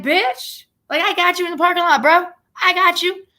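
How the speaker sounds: high, fast, daring callout; mock tough taunt. Retelling her dare to a guy at the concert, mock tough taunt. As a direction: throw down the dare like a street fighter.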